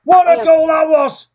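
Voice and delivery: high, loud, slow drawn-out yell; long goal yell. Real, not acted.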